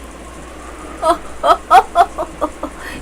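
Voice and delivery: a short breathy nervous laugh. Acted. A frightened ally laughing nervously at the talk of killings. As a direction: let a nervous laugh slip out.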